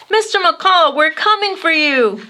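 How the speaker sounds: calling out high to the kite in the tree; a boy calling up into a palm tree, shouting. A narrator's reading. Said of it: "shouted Maxton".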